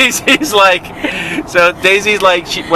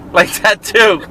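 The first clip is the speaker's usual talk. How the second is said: ordinary talk, nothing marked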